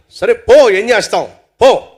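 loud, exasperated dismissal; resigned but forceful send-off; grudging forgiveness. Acted. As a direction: wave him off, giving in. Friend grudgingly lets him go.

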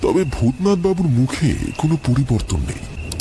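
ordinary delivery

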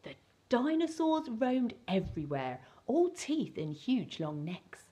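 ordinary delivery

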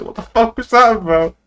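a friend's private message, imitated, loud and mock-exasperated; mock outraged demand. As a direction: throw the line back at him, fake-furious. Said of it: "I'm gonna get a D M from him later and say".

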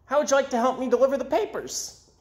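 eager paperboy, quick and high; newsboy voice. Acted. Newsboy asks George to deliver papers, high and quick. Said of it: "he said to George".

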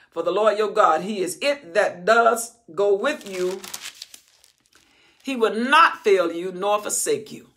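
loud, slowed scripture reading; preaching at full force. Acted. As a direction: declare the verse.

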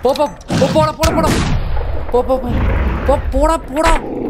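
high, loud frantic shouts; panicked yelling. Real, not acted. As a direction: scream at the attacker in real panic. Monster attacks the player.

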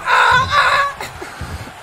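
high imitated shrieks from a kid; imitated scared cries. Commentator imitating the kid at ringside, imitated scared cries. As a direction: mimic a child's high-pitched cry. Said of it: "The kid's going".

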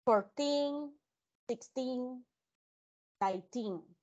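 put-on "Fourteen", "sixteen", "nineteen" with the stress on the final teen. Shown as a demonstration. Teacher models the stressed teen ending for the class. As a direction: lean on the teen ending of each number. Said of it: "póngale acento a esa I".

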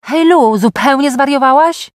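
high, loud cry of disbelief; yelled exclamation. A narrator's reading. Dad yelling at his daughter over her mini dress, loud disbelief. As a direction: call out in loud, high-pitched astonishment. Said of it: "wskazał na mnie palcem i wrzasnął".

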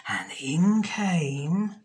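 plain reading voice

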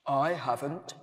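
low-pitched, ponderous toy; slow, deep voice. A narrator's reading. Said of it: "which was slow and deep".

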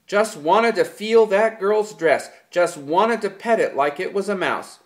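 mocking little girl. A narrator's reading. George mocking Lenny's excuse in girlish mimicry. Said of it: "elaborate manner of little girls when they are mimicking".

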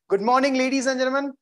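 ordinary talk, nothing marked

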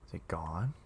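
hushed, low question; very soft, wary whisper; scared whisper. Real, not acted. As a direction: whisper it from a hiding place. Hiding scared in the stall.

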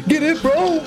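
high, loud hype yell; excited shout. Real, not acted. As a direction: cheer it on, all in. Excited streamer cheering on game.

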